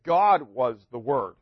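emphatic, slowed "God was the Word"; stress on GOD. Shown as a demonstration. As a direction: hit "God was the Word" slowly and firmly. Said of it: "It's more emphatic".

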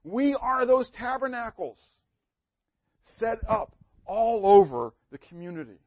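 mounting sermon fervour; rising exhortation. Acted. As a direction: lift them up into the call.

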